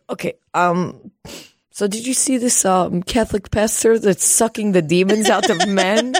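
hesitate, then switch abruptly to a casual question; dad changing subject. Retold or imitated. Tearful dad abruptly changing the subject with daughter, imitated. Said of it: "he immediately switches gears to that".

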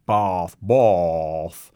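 "Bath, bath." with an overdone, drawn-out broad British vowel; exaggerated British vowels. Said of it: "exaggerated vowels that Americans do".

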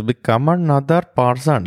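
imitating a cheesy two-thousands film Indian accent; bad Indian accent. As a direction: ham up a shitty movie Indian accent. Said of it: "shitty, generic Indian accent".

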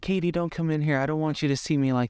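high-pitched imitation of a doll character; Megan. Film fan quoting the doll warning Katie away, high-pitched imitation. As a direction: quote the doll in a voice pitched well up. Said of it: "she's just like".

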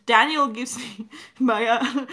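usual speaking voice

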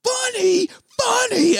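high-pitched, repeated mock scream; imitated enraged yelling. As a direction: yell each word like a gunshot. Acting out enraged shooting of bartender.

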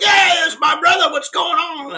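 high, loud buddy hail across the lobby; excited greeting. Retold or imitated. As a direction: greet your new best friend. MC greets him warmly in lobby.

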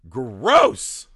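a loud, sneering "gross"; disgusted scoff. Retold or imitated. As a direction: spit the word out in disgust. Arnold sneering at working-class people.

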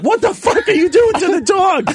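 dad shouting at his son over the dog; furious dad. Retold or imitated. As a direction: yell at the son from the window. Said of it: "he's like".

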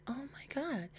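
barely audible, all breath; exasperated whisper. Real, not acted. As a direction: whisper it to yourself in disbelief. Exasperated aside over the name.

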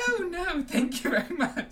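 high, loud, sugary Umbridge-style put-on voice; hammed-up simpering politeness. A narrator's reading. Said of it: "with that simpering laugh Harry hated so much".

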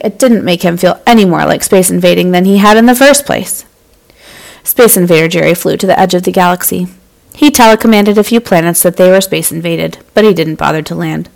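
ordinary delivery

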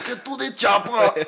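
mock action-star impression; hammed-up action hero. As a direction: belt out a slow action-film catchphrase. Quotes Arnold's famous film line.

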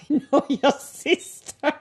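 high, slow and amazed; excited. Retold or imitated. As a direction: marvel at the small world. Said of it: "I'm like".

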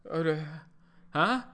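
confused, jumping up in pitch on huh; just woken with a jolt; startled awake. Retold or imitated. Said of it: "this guy was like".

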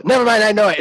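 high, loud cutting in; imitating impatient child. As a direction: blurt it like an insistent kid. Voices child snatching work back.

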